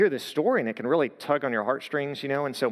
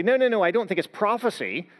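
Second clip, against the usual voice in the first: higher-pitched, emphatic imagined objection; mock objection; 7 semitones higher, 3 dB louder than usual. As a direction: object quickly in someone else's shoes. Voices an objector's reply.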